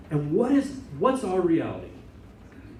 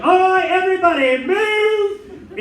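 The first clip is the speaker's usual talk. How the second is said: high, loud shout to clear a crowd; shouting usher; 8 dB louder, slower than usual. Retold or imitated. Little usher shouting at the crowd, high and loud. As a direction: bellow at the crowd to move. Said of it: "he's like".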